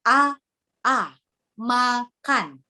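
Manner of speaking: A, A, MAKAN each sounded separately and cleanly; careful diction demo of "A, A, makan"; clear articulation. Shown as a demonstration. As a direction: articulate "makan" syllable by syllable, with pauses. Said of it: "pengucapan kata yang benar dan jelas".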